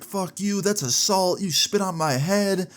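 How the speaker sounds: high-pitched, drawn-out indignant rant; hammed-up high scolding; mock outraged lady. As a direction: imitate an irate woman going in on him. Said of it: "this lady was not having it".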